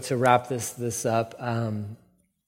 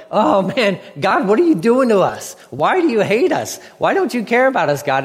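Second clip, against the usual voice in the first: higher, louder mock wail of dismay; panicked complaint; 6 semitones higher, 4 dB louder than usual. Brothers find their money and cry to God, imitated panicked complaint. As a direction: imitate alarmed men protesting to God. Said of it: "they're immediately like".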